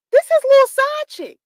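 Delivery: emphatic repeat. Retold or imitated.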